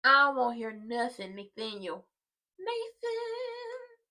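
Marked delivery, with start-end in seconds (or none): 2.6-4.0 calling out. Acted. Calling out the husband's name.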